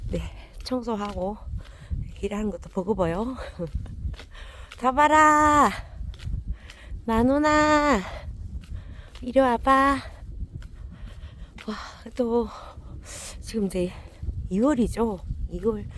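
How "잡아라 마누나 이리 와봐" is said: loud high call to the dogs. Real, not acted. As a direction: shout across the field so they come. Calling the dogs to come.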